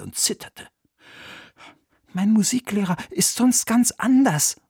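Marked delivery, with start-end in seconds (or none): 2.1-4.6 whisper. Acted. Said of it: "flüsterte Oliver".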